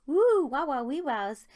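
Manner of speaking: high, loud playful whooping; cheeky excited woo; excited whoops. Real, not acted. Reacting to raunchy book blurb.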